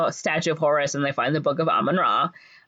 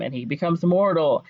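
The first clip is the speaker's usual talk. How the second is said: ordinary delivery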